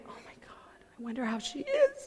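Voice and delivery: hushed near-whisper with a little laugh; hushed aside. Retold or imitated. Lost in thought at the table, murmuring softly with a laugh. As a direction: murmur it to yourself while picturing someone. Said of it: "And then I'm like".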